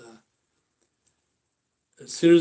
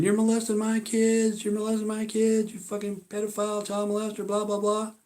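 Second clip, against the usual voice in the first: mimicking her accusation; 7 semitones higher, 3 dB quieter than usual. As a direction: parrot her wild accusations.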